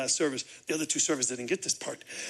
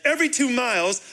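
ordinary talk, nothing marked

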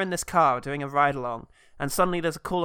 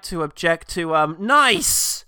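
mock courtroom objection; 5 semitones higher, 6 dB louder than usual. Shaq as lawyer in film.

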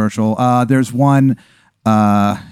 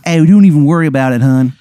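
bad Maryland accent; 3 semitones higher, 5 dB louder than usual. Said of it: "he doesn't know how to do the accent".

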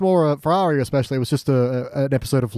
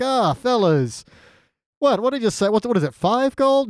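mock surprised Davos; 7 semitones higher than usual.

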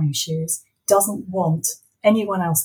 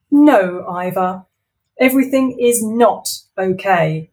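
loud firm villain; stern Wendy; 6 dB louder than usual. Acted. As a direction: shut him down. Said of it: "Wendy replied".

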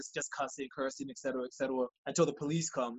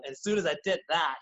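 ordinary talk

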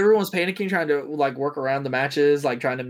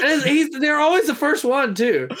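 laughing; 11 semitones higher, 4 dB louder than usual. Real, not acted. Laughing while talking.

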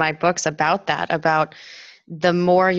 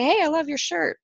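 friendly stranger; 10 semitones higher than usual. Retold or imitated. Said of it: "or say".

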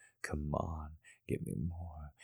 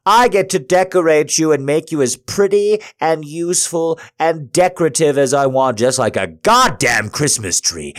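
shouting; 9 semitones higher, 15 dB louder than usual. Acted. Dominant partner shouting at climax.

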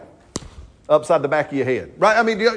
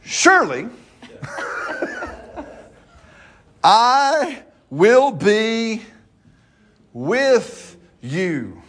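slow, drawn-out promise, a touch higher; lightly voiced God stretching out "Surely"; God speaking; 6 semitones higher, much slower than usual. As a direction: voice God's reassurance, very slowly. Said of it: "So God's going".

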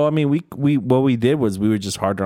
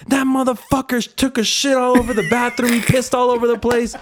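high, imitating her disgust; higher-pitched imitation of her outrage; mock outraged lady; 13 semitones higher than usual. Imitating the woman complaining.

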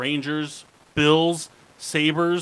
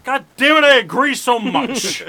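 excited shout; 9 semitones higher, 11 dB louder than usual. Real, not acted. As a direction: bellow your agreement. Loud joking outburst of agreement.

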